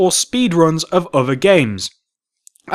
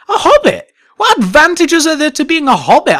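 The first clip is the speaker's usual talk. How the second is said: surprised; 8 semitones higher than usual. Real, not acted. Incredulous at the Hobbit option.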